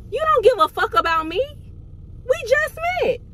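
retelling her furious reply to the reckless driver; furious high outburst. Retold or imitated. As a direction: pitch shooting up, mad and fed up. Angry retort after near crash.

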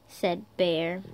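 storybook read with lightly voiced Dog and Bear; reading to children. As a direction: play the two friends gently for kids.

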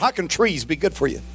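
normal talking voice

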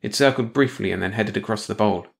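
ordinary delivery, nothing marked

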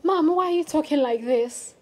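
normal talking voice

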